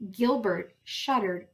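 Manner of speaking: ordinary delivery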